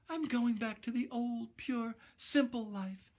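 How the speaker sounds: a sorry dame vowing to go straight; repentant young woman. Acted. Narrator voices Gloria.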